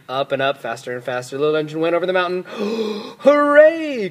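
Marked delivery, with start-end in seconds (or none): 2.5-3.1 sharp, high intake of breath; thrilled gasp. A narrator's reading. Toys see the engine get over, thrilled gasp. 3.2-4.1 high, loud, jubilant cheer; cheering cry. A narrator's reading. Clown and toys celebrate crossing the mountain, cheering. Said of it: "cried the funny little clown".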